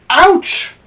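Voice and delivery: high, loud hammed-up "Ouch!"; a sudden stung cry; pained yelp. Hit by the pitch, Amelia Bedelia yelps in mock pain.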